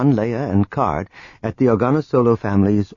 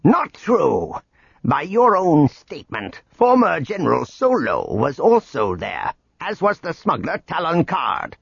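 higher, sharp accusing voice; sharp retort; 6 semitones higher than usual. A narrator's reading. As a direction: snap it back, cold and cutting. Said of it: "Dixano shot back".

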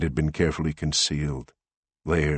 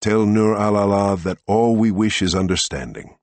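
louder, higher diplomatic appeal; formal raised voice; 6 semitones higher, 9 dB louder than usual. A narrator's reading. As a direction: project it like a senator.

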